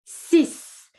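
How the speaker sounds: six ending in a hissed S; six, final X said as S. Shown as a demonstration. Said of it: "pronouncing the X like a S".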